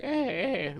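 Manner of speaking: high, soft mock whimper; put-on high whimpering; mock crying whine. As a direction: whine like a little crybaby, mockingly. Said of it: "He sounds like a little crying girl".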